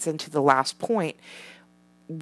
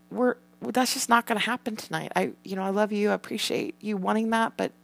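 softer, kind but firm; gentle refusal; 5 semitones higher, 5 dB quieter than usual. Retold or imitated. Mother retells softly telling son no TV tonight, gentle. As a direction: let him down tenderly.

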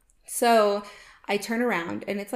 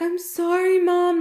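mock crying apology; 9 semitones higher, 7 dB louder, slower than usual. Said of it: "like, crying".

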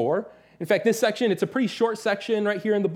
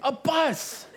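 put-on much higher, gleeful toddler cry, half laughing; excited toddler; 10 semitones higher than usual. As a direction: shout it like a thrilled little kid spotting a plane. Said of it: "he'll point up and be like".

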